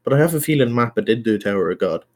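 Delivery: ordinary talk, nothing marked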